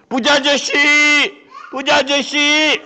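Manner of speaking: high calls, then a loud startled cry; calling out. Acted. Rich man retelling the call that startled him. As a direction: call out, then jump in fright.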